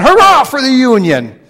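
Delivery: high, loud retold battle cry; a wounded soldier shouting to be found; shouted cheer. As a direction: yell it out defiantly across the field. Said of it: "so he shouted out".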